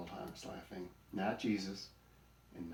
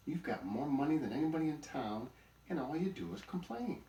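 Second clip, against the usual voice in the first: higher, louder rebuke; exasperated scolding; 7 semitones higher, 5 dB louder than usual. A narrator's reading. Buzzard telling off the donkey, high and exasperated. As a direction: tell off a chronic complainer.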